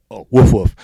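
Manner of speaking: loud playful woof woof; mock barking. Singer joking after blah blah, loud mock barking. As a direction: bark it like a dog for a joke.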